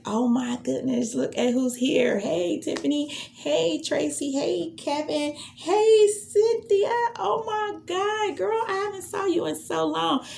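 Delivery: high, gushing welcome to each guest; excited greeting. Shown as a demonstration. Host welcoming live guests in a high excited gush. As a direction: squeal hello like old friends just walked in. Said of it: "Say something like".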